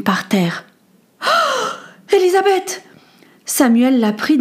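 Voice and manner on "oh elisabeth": high, shocked gasp of her name; alarmed cry. Acted. Boy finds his friend unconscious, crying out in alarm. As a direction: cry out in sudden fear.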